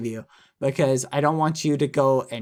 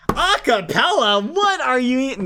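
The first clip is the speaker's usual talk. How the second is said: mock roasting; 8 semitones higher, 3 dB louder than usual. Streamer jokingly roasting a donor about acapella, mock disbelief. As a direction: jeer it in a high, exaggerated voice.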